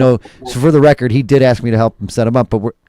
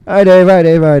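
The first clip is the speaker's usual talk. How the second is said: high, bright sing-song hello; playful loud greeting; 7 semitones higher, 5 dB louder than usual. Acted. Host calling hello to Dave, loud and playful. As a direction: call out the greeting cheerily.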